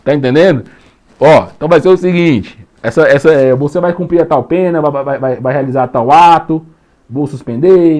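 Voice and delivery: a judge yelling at the defendant; stern judge scolding. Acted. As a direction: play the judge dressing down the accused, loud. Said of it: "Aí o juiz vai falar pra ele".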